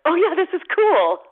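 high, loud delight. Retold or imitated. At the after party with friends, high loud delight. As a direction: gush about it. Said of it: "and you're like".

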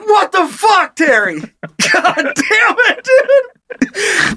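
high, drawn-out cry of disbelief through laughter; hammed-up exasperation dissolving into laughter; laughing mock exasperation. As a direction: exclaim it as the exasperated boss while cracking up. Acted exasperated boss, cracking up.

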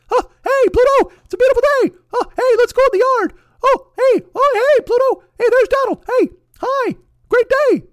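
loud, very high cartoon mouse falsetto; Mickey Mouse. Cartoon mouse greeting Pluto, absurdly cheerful high impression. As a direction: squeal it out like a chipper cartoon mouse. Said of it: "Shut up, Mickey".

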